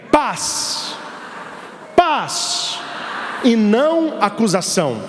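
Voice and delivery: high, drawn-out chanted paz; call and response. Shown as a demonstration. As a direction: sing it out for them to repeat. (chamado para repetir, agudo e arrastado)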